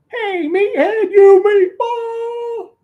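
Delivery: high, slow, drawn-out yell; yelled taunt. Retold or imitated. Taunting the driver from the roadside, a slow high yell. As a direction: holler it at the passing car like a taunt. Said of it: "And I yelled".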